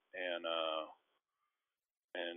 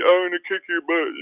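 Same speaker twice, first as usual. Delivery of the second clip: high, loud mock threat; comically overdone taunt, pitched way up; hammed-up trash talk; 11 semitones higher, 9 dB louder than usual. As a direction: put on a silly trash-talker voice. Acting out inept trash talk.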